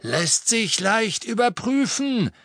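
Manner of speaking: high, slow, drawling henchman; Scabior, suspicious. Acted. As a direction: draw it out high, as a threat.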